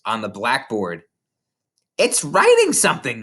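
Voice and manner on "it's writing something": high, loud shriek; scream. A narrator's reading. Nigel screaming at the chalk, high and loud. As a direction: yell it in panic. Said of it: "Nigel screamed".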